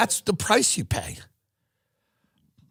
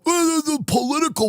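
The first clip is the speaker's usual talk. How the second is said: mock critic; 12 semitones higher than usual. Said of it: "before you yell at me and go".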